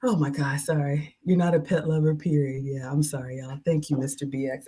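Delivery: normal talking voice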